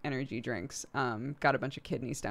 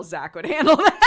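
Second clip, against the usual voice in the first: laughing through the words; 9 semitones higher, 12 dB louder than usual. Real, not acted. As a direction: giggle your way through it. Amused at Zach's prospects.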